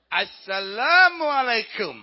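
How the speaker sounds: slow, booming acted reply; hearty called greeting. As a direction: sing out the greeting proudly across the distance. Soldier answers the call.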